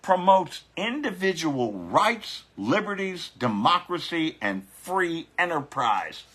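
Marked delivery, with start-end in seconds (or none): none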